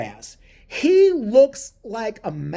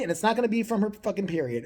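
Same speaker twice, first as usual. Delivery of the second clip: ordinary delivery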